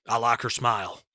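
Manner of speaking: put-on low, soft regional American accent; Dwayne Johnson. Imitating the star's line about his new partner, southern-tinged American accent. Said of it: "he says it like that".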